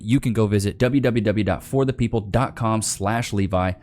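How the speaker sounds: normal talking voice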